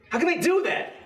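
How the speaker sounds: high-pitched, agitated outburst; worked-up, high-pitched outrage; angry. Acted. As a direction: voice shoots way up in indignant anger. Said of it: "It makes me, it makes me angry".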